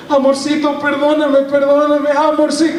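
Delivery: high, frightened pleading; scared husband begging. Retold or imitated. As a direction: beg for forgiveness in a high panicky voice. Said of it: "se pone todo bien asustado".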